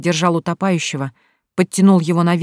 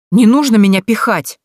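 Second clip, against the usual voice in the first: higher and louder protest; indignant shout; 4 semitones higher, 6 dB louder than usual. A narrator's reading. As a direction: yell back in protest. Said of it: "выкрикнула она".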